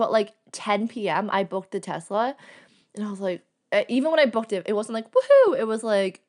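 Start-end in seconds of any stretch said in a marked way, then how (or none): 5.1-5.6 much higher, louder hammed-up woohoo; mock cheer. Cheer she did not give on booking, in a hammed-up high woohoo. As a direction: throw out a fake big cheer.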